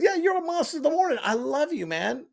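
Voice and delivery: high-pitched gushing stranger; excited fan. Retold or imitated. Stranger recognizing the attorney on a mountain, high excited fan voice.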